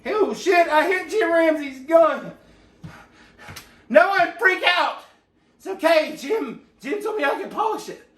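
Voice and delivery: high, slow, alarmed cry after dropping the gun; hammed-up fright over knocking the gun down; mock panic, high and slow, with a yelp on Jim. Drops Jim's gun, panics.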